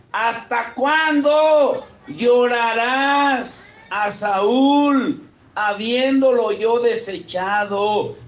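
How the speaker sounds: drawn-out pulpit reading; scripture reading. Acted. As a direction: read the verses with weight. Reads scripture passage aloud.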